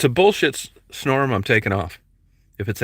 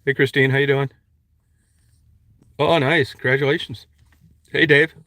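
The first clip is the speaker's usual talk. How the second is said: calling out; 4 semitones higher, 4 dB louder than usual. Real, not acted. Calling out greetings to passersby.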